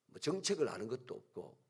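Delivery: ordinary talk, nothing marked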